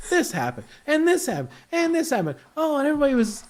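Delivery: high, hammed-up bad-news recap; mock news recap. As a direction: rattle off disasters in a high voice. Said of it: "It was just like".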